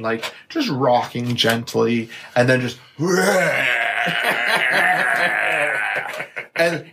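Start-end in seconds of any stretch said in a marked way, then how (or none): 3.0-6.5 long retching spew noise; imitated baby vomit. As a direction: mimic a baby projectile vomiting. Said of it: "And so she threw up".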